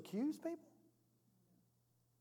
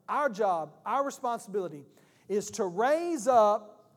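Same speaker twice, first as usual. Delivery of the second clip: preaching louder, rising; 7 dB louder than usual. Acted. Preaching builds to climax.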